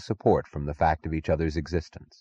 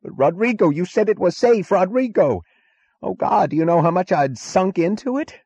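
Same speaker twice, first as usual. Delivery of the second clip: high, loud, panicked scream down the phone; high-pitched frantic screaming; distorted screaming; 12 semitones higher, 5 dB louder than usual. A narrator's reading. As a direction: scream it in desperate accusation, pitched way up. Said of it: "his distorted voice screaming".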